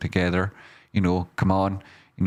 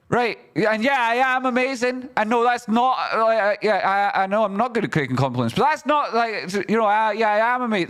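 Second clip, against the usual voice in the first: cocky self-praise; 3 dB louder than usual. Real, not acted. Mock self-praise after rant.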